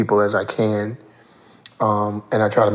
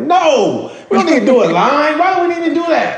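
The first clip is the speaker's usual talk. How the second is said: much higher, laughing, eager; excited protest; 14 semitones higher, 3 dB louder than usual. Retold or imitated. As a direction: brush off the line idea with glee. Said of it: "I was like".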